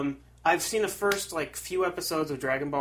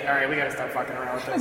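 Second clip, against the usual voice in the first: normal talking voice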